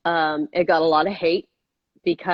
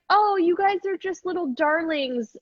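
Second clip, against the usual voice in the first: high-pitched patronizing gush; lightly mocking, sing-high flattery; mock cooing praise; 11 semitones higher than usual. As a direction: imitate someone fawning over little darlings.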